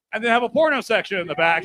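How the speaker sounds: ordinary talk, nothing marked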